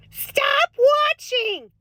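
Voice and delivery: high, slow and stretched; a sing-song Minnesota-mom scolding; drawn-out plea. Acted. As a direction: plead with the viewers, stretching it out. Urging viewers to quit news.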